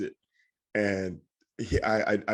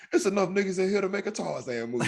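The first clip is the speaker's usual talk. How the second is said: higher voice, laughing through the punchline; imitating the comic; 7 semitones higher than usual. Guest retelling the comedian's Tarzan joke, higher with a laugh. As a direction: deliver the joke like a stand-up punchline. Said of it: "I love just the way you say that".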